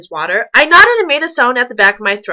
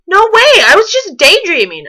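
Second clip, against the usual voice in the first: high, loud protest; shrill-free high-pitched denial, loud; defensive denial; 8 semitones higher, 5 dB louder than usual. Acted. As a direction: blurt out a defensive no way. Girl denies being scared.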